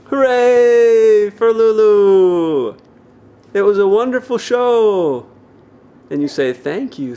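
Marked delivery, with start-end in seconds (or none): none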